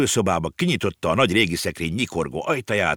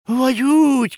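high, loud cry of sudden realisation; exclaiming; 6 dB louder than usual. A narrator's reading. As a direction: call out all at once, surprised. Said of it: "kiáltott fel Ringelhut". (hangos, meglepett felkiáltás)

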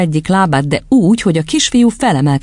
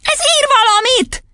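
squeaky-high astonished cry; falsetto shriek. A narrator's reading. Boy seeing the chalk write, shrieking in falsetto. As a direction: squeal it in head voice. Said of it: "visította fejhangon Otto".

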